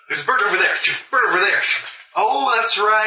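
playing a distracted bird-loving character. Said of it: "So, so Raven's like".

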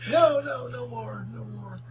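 high, begging prisoner voice; pleading human captive. Acted. DM voicing a captive begging for mercy. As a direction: beg with a high strained cry. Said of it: "you hear a human voice saying".

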